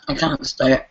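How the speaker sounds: normal talking voice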